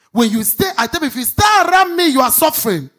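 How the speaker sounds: loud, fast, high preaching burst; preaching at full force. Acted. Preacher warning the congregation about lip service, shouting. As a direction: hammer the warning home to the congregation.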